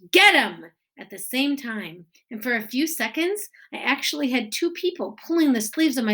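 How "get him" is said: loud screamed order. A narrator's reading. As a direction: yell it like the chaser giving orders. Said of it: "Someone else screamed".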